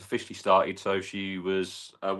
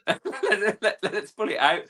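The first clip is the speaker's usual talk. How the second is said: laughing; 10 semitones higher than usual. Real, not acted. Laughing about his old lycra.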